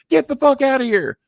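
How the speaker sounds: loud, high mock yell; high-pitched shouted order, acted out; retold angry cussing. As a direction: imitate a boss cussing someone out. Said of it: "he's cussing me like a sailor".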